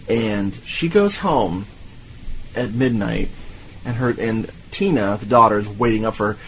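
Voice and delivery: usual speaking voice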